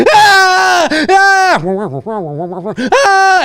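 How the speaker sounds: long piercing mock screams; mock child wail. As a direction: bawl like a kid in a movie theater. Imitates the kid crying in cinema.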